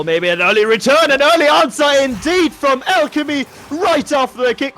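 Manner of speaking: high and loud, rising with the goal; surging play-by-play; excited goal call. Real, not acted. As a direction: call the equaliser with a jolt of energy. Quick answer goal called.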